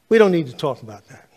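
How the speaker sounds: curt brush-off. Retold or imitated. Retold man waving off the preacher, loud and curt. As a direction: shut the topic down hard.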